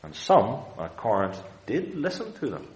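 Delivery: ordinary talk, nothing marked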